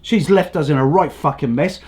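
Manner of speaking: ordinary talk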